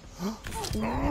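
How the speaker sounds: sharp, high intake of breath; startled gasp. Real, not acted. Player ambushed in the game, startled gasp. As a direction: gasp in sudden shock.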